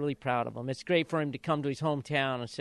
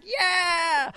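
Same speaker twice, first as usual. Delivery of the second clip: high, loud joyful whoop; a crowd's sudden happy yell; cheering yell; 14 semitones higher, 5 dB louder than usual. Retold or imitated. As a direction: let out a big cheer of relief. Cheering when jockey stayed on.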